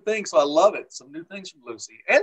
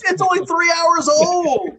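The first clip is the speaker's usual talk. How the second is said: laughing protest; 9 dB louder, slower than usual. Real, not acted. Defends his haircut, laughing.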